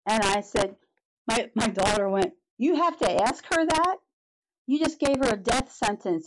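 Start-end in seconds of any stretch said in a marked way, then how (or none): 2.6-6.2 sharp, higher, outraged challenge; indignant retort. Retold or imitated. Daughter defending mom from the doctor, indignant. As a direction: daughter snapping back at a callous doctor.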